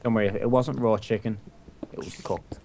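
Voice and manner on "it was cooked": whispered aside. Real, not acted. Sheepish aside about raw chicken.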